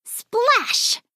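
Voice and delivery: storybook splash; splash sound. A narrator's reading. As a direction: pop it like a sound effect. Falls into pool, sound word.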